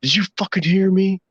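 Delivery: high-pitched indignant petty officer; mock chewing-out. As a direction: play the third class demanding an answer. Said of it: "started tearing into everybody's ass".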